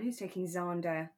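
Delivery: ordinary talk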